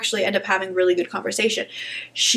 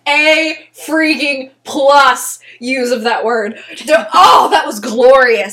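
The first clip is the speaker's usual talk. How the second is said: delighted; 2 semitones higher, 6 dB louder, much slower than usual. Real, not acted. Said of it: "I'm in heaven".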